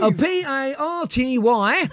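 lightly voiced comic spelling; Ace Ventura catchphrase. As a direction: spell it out letter by letter, very slow. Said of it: "that was that Ace Ventura that used to do that".

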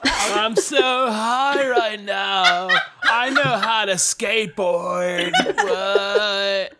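slow, dazed stoner voice. Pretend. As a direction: drawl it like a nineties TV stoner. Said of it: "nineties television high".

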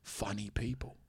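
very soft, whispery British impression; hushed, earnest British star; Jason Statham. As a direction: confess it in a near whisper. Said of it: "whisper it a little bit".

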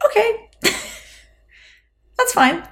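very high, falsely agreeable reply; high polite voice. Retold or imitated. Teacher humouring a boastful dad, high polite voice. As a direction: give in up high, trying not to be mean. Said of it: "your voice goes all high".

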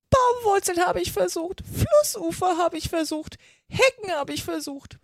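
high-pitched, wailing bird voice; distraught pigeon. A narrator's reading. Pigeon complaining about snakes to Alice, distraught. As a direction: list grievances as a frantic pigeon.

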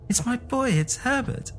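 high, frantic mother; desperate old woman. A narrator's reading. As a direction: plead for her son. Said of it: "she cried".

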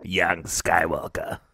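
unvoiced, low whisper. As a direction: breathe it like a wise old master. Star Wars quote whispered.

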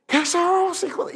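high-pitched mock cry; acted blind man's cry; imitated shout. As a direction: call out high, like someone shouting to be heard. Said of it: "comenzó a gritar".

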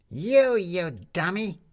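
slow, high exasperated jab. A narrator's reading. Tin Lizzie exasperated with Jefferson, slow high scolding. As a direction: lose patience with him. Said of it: "couldn't stand it any more".

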